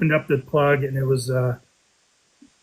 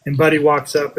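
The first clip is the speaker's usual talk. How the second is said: ordinary talk, nothing marked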